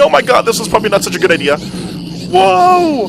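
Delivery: thrilled, high and loud; yelling with glee as they swarm; excited. Real, not acted. Pigmen swarm him, thrilled.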